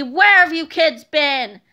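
slow, loud, furious demand; angry principal scolding the kids; angry shout. A narrator's reading. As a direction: drag each word out in anger. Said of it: "shouted Mister Krupp angrily".

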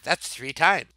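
normal talking voice

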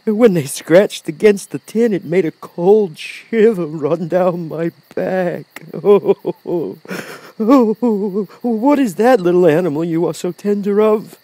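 high, drawn-out wavering lion with ohs; Cowardly Lion, shuddering. A narrator's reading. Lion recalls shiver, asks.

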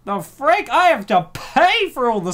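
loud, high comic indignation; mock outrage. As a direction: wail in jealous, hammed-up protest. Mock outrage at free cookies.

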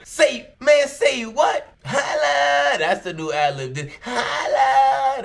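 hammed-up crackhead cry of Holla; imitating the holla ad lib. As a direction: yell it like his ringtone ad lib. Copying the man's ad lib.

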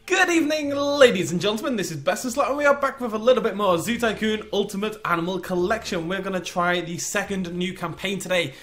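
loud, high announcer welcome; ringmaster-style greeting, high and loud, easing slightly; show-opening announcer. Acted. Opens the show.

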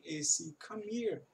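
slow, with "come" flowing into "here"; slow linked demo. Shown as a demonstration. Teacher slowly models linked speech for students. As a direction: join "come here" into one slow sound. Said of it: "o final do come ele já junta".

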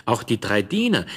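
plain reading voice

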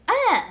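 put-on short high baby grunt; babies' "uh". Mother imitates babies' attempt at saying bird, high blurt. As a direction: squeak a wordless attempt at the word. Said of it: "they will say".

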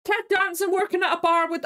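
ordinary talk, nothing marked

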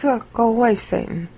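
ordinary delivery

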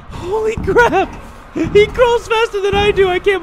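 high, loud shrieks of terror; real frantic squealing, near crying; panicked screaming. Real, not acted. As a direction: scream and beg as the monster gains on you. Chased by a fast crawling monster.

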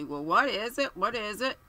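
brisk, impatient demand; sharp bark. A narrator's reading. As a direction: snap the question out like an irritated colonel. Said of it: "barked sharply".

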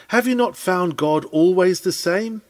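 normal talking voice

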